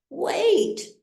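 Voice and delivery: sudden high cry of protest. A narrator's reading. Patient cries out to leaving doctors, high and urgent. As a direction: call out desperately to stop them.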